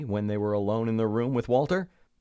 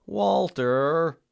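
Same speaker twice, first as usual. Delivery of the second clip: drawn-out, raised, louder scolding of a name; blaming call; 7 semitones higher, 5 dB louder than usual. A narrator's reading. Uncle Irv blaming the dog for his slip, scolding. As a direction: call out the culprit, higher and louder.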